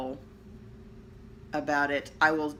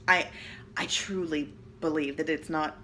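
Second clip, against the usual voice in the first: ordinary delivery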